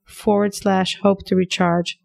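upbeat sponsor read, pushing the offer. Acted. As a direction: sell it like a podcast ad.

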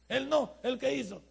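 normal talking voice

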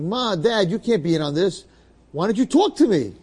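high, loud plea; exasperated pleading. Acted. Son begging his parents to answer him, exasperated pleading. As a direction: plead loudly, pitch leaping up.

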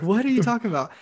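imitating a stunned boss; baffled disbelief. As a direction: ask it as if utterly taken aback. Director's baffled reaction, retold.

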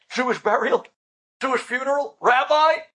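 mocking scoff. Daughter mocking mother's burial wishes, scornful scoff. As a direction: throw her requests back at her.

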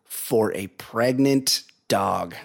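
slow, spaced-out delivery for comic weight; drawn-out incredulous summary; deliberate punchline. Real, not acted. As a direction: spell out the absurd conclusion slowly. Host spells out the absurd punchline.

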